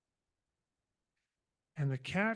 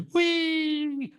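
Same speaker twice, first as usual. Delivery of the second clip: a high, gliding falling cry of whee; the kitten shooting down from the tree with a high whee; 11 semitones higher, 4 dB louder than usual. Acted. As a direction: sail down from the tree going whee.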